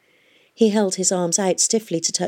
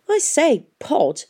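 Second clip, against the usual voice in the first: high-pitched, indignant start falling away; mildly angry old guildsman's complaint; 9 semitones higher, slower than usual. A narrator's reading. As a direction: protest crossly, pitching the opening up high. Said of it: "complained Nancarrow angrily".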